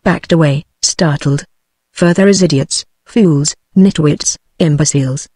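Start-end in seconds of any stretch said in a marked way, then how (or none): none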